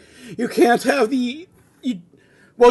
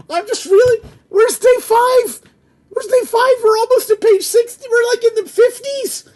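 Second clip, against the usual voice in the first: much higher and louder, fed up; exasperated high voice; 12 semitones higher, 6 dB louder than usual. Real, not acted. Groaning at the long script, high exasperated wail. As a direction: wail about the endless script. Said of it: "I don't know why I'm reading all the lines like this".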